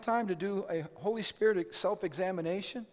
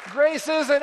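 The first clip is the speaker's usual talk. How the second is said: ordinary delivery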